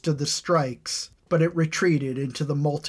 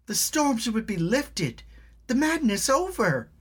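put-on high, urgent young girl; pleading girl; 9 semitones higher than usual. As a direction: beg him to fly up there. Girl Elna pleads with dragon.

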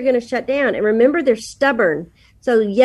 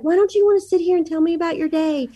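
higher, fast, lightly wounded plea; mock-hurt question, pitched up; offended mom; 6 semitones higher, faster than usual. Mom offended that her son goes to the basement, mock hurt. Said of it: "I was so offended by it".

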